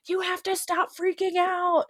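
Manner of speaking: imitating husband urging her to calm down; husband telling her to calm down. Husband tells panicking wife to stop freaking out, mock-pleading. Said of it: "He's like".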